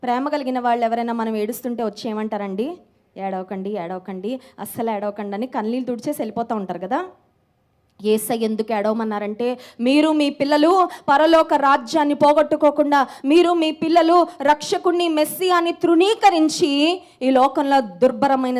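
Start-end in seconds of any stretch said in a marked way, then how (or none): 3.2-5.4 soft, low hushing of someone in tears; gentle consoling. Acted. Loved one consoling someone who is crying, gentle consoling. Acting a loving comforter. 9.8-18.6 raised, driving sermon delivery; forceful preaching. Real, not acted. Preacher warning mothers about their children's salvation, forceful preaching.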